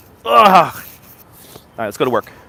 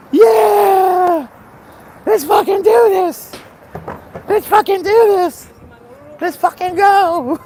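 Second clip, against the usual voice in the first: hype yell; much slower than usual. Real, not acted. Streamer firing up his live audience, roaring. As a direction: scream it like a pumped-up crowd.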